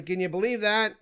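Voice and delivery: ordinary talk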